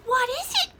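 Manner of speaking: high, loud rooster voice; crowing rooster. Acted. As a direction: ask it like a startled rooster. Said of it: "crowed the rooster".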